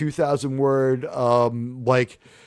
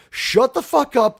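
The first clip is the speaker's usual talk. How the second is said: angry shout; 9 semitones higher than usual. Retold or imitated. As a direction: snap at him full force. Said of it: "He goes from".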